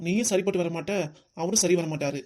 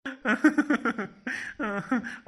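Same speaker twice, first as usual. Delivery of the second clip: a long high laugh at the mother's plight; laughing out loud; 5 semitones higher than usual. Acted. Son laughing while his mother suffers, long high laugh. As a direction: laugh freely and high-pitched. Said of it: "அதான் இப்படிதான் சிரிப்பியா நீ?".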